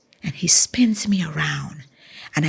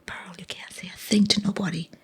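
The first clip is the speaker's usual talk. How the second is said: hushed coach, whispered and secretive; whispered warning; 3 semitones higher than usual. Retold or imitated. Coach hushing her to secrecy.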